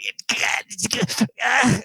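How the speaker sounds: high, choked wand-casting gibberish noise; imitated strangled sputter. Podcaster mimics a man raising his wand, strangled sputtering.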